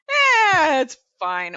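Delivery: dismissive shrug. Retold or imitated. Fans shrugging off the team.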